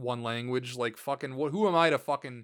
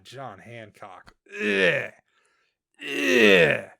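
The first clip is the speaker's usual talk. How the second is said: disgusted groan; 6 dB louder, much slower than usual. Real, not acted. Disgusted at naming him.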